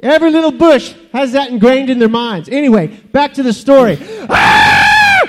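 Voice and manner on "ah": long, piercing mock-panic scream; hammed-up scream. Bush resumes its burning story, hammed-up scream. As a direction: break out screaming for comic effect. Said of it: "I'm screaming 'cause I'm on fire".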